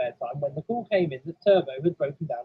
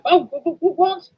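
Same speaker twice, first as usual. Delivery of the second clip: high, loud stammer; startled awake; 12 semitones higher, 7 dB louder than usual. Acted. Engine woken by driver's shout, startled. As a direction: jolt awake, tripping over the word.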